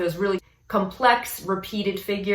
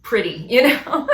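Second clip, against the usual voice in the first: laughing; 4 semitones higher, 6 dB louder than usual. Real, not acted. Laughs while describing tone.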